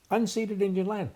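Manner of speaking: mock-quoted slogan, weight on "Unceded"; stress on unceded. Shown as a demonstration. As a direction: hammer "Unceded" as a scornful quote. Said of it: "its own unceded Indian land".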